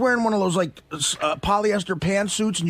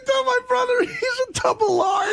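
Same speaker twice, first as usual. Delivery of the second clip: laughing. Real, not acted. Teller laughing through punchline.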